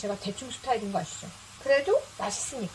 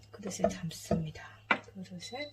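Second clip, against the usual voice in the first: leaning in to whisper; 4 semitones lower, 7 dB quieter than usual. Real, not acted. Hushed aside while plating.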